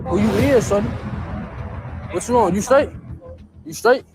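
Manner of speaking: loud, confronting street tough; tough guy act. Pretend. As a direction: get in their face like a menacing stranger. Prank persona confronting stranger.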